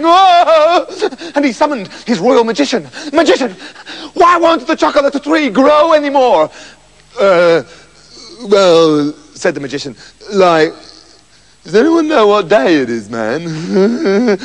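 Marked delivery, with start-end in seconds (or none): 0.0-0.9 loud high cry of grief; howl. Acted. King mourning his tree, loud high wail. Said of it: "howled the king". 3.1-6.5 distressed king. Acted. King summons the magician. 7.2-14.4 hippie magician. Acted.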